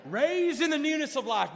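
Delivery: high, very loud exultant cry; triumphant shout. Retold or imitated. Preacher lifts his daddy from the water, triumphant shout.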